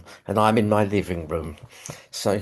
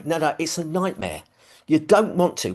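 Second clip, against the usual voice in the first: normal talking voice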